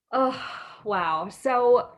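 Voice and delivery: usual speaking voice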